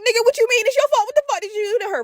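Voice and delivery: high-pitched mock outraged questioning; accusing. Adults confronting the scared brother at hospital, accusing. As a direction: pounce on the confession, voice way up.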